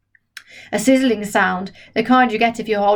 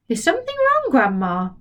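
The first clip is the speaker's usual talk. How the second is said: put-on much higher, polite boy voice; 7 semitones higher than usual. George politely asks Grandma if something is wrong. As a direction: ask politely and sweetly. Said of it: "asked her politely".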